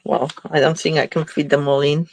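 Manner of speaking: normal talking voice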